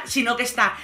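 ordinary talk

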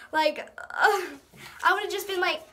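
normal talking voice

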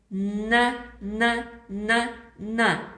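на, на, на, на chanted evenly; teacher drilling the syllable на. Shown as a demonstration. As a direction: chant на, на, на for the class. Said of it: "Вимовляємо н".

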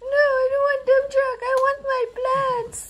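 sobbing laugh then pouting wail; squeaky mock sob. Mother refusing the toy truck, squeaky mock crying.

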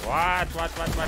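high, loud repeated whats; panicked what. Real, not acted. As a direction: blurt it in rising alarm. Ambushed mid-fight.

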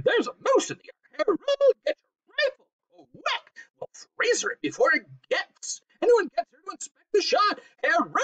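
high, frantic screeching orders; shrieking housewife in a panic; screeched. A narrator's reading. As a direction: screech it at your husband. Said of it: "she screeched".

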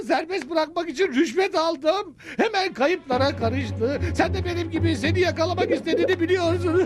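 quick words breaking into laughter; nervous laughing. Acted. Confessing mayor laughs nervously. (gergin gülerek, hızlı)

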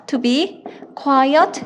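very slow, word by word; slow dictation. Shown as a demonstration. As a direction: spell it out slowly for students to write. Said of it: "이렇게 쓰시면 됩니다".

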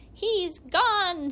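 long-toothed hag echoing the alarm; hag's cry. A narrator's reading. Hag echoing the cry, high and loud. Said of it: "said one of the long-toothed hags".

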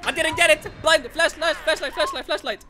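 frantic, high, loud panic; being chased. Real, not acted. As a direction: yell it while fleeing.

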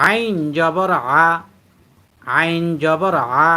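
slow drill, "আ" pressed from the throat; pressed ayn. Shown as a demonstration. Teacher explains ayn must be pressed, slow throaty "আ". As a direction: draw out "আইন" and "আ" with a pressed Arabic ayn. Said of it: "ডাবি উচ্চারণ করতে হয়".

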